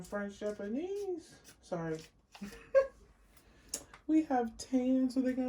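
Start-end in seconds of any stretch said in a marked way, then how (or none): 2.3-2.9 short high laugh bursting out; burst of laughter. Real, not acted. Giggling at her own slip. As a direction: laugh at fumbling the list. Laughs after stumbling over list.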